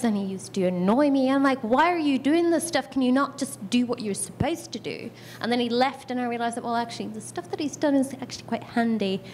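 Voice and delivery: ordinary talk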